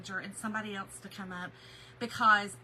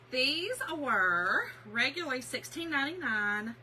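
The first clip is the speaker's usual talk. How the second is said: ordinary talk, nothing marked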